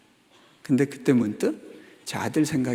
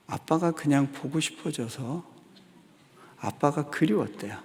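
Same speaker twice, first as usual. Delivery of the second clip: ordinary talk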